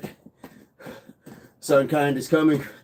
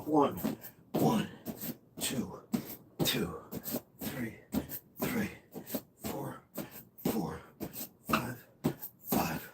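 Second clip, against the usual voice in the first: very soft, breath-led counting; hushed rep count; 12 dB quieter, slower than usual. Real, not acted. Trainer counting through a new set, hushed. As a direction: count reps in pairs under your breath. Counting reps during exercise.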